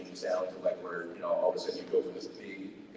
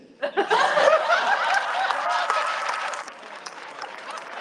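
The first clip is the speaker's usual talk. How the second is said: long high laughing fit; 7 dB louder than usual. Real, not acted. Long laugh after pledge.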